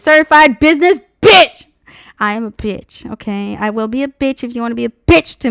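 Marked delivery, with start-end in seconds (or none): 0.0-1.7 loud, high, triumphant declaration; proud yell. Real, not acted. Boasting to viewers about herself, loud and proud. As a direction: belt it out like a slogan. Repeating her catchphrase proudly.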